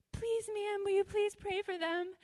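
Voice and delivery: high-pitched imitation of someone begging; pleading request. People asking her to pray, high imitated pleading.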